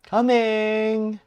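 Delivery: high, drawn-out call to a customer. Acted. Voices game character calling out.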